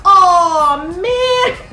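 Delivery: high, crying "oh, man"; mock wail. Viewer seeing Karen lying still, high crying wail. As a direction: wail it like you're about to cry. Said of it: "So I'm like".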